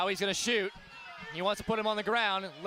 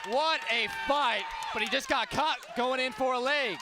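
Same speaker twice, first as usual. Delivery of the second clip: excited shout; 7 semitones higher than usual. Real, not acted. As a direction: shout the winner over the crowd. Commentator calls the title win.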